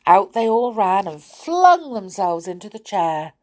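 usual speaking voice

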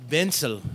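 "Bensel", pencil with a B sound for P; Indian accent P as B. Shown as a demonstration. Showing friends the accent's P swap, "Bensel" starting with B. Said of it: "It's a P's or B's".

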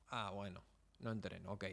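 más grave y mucho más suave, resignado; resignación en voz baja. Acted. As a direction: admitirlo por lo bajo, sin excusas. Se admite que no entrena. (admitiendo en voz baja que no entrena)

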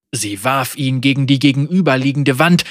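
plain reading voice

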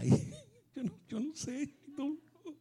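giggling high through the words; laughing. Retold or imitated. The joyful woman laughing to her husband. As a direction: laugh while answering, can't stop.

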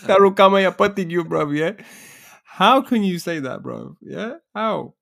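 high, fast mock scolding. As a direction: tease him in high pitch. Mock-gibberish, high mocking retort.

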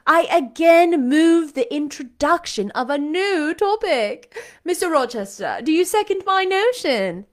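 a haughty beauty mocking governesses; sarcastic. A narrator's reading. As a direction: sneer at the whole room from above. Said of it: "curling her lips sarcastically".

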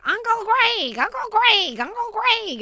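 put-on high, eager child calling uncle; a little boy tugging for attention; kid calling out. Son calling his uncle, high child voice. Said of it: "my son is like".

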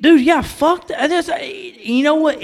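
high, drawn-out, laughing outburst; exasperated, half-laughing high cry; fired-up agreement. Real, not acted. Reacting to the played rant.

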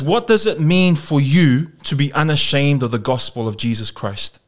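usual speaking voice

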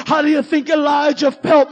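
usual speaking voice

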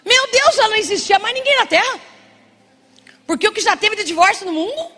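high, loud, impassioned preaching; fiery preaching. Acted. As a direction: drive the point home from the pulpit. Preaching at full force.

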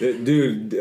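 usual speaking voice

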